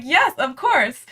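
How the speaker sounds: a light eager yes, drawn out. Retold or imitated. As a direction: grab the offer with delight. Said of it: "And I was like".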